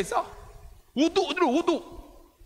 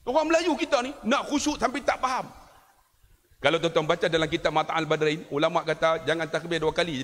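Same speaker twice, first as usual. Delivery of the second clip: ordinary delivery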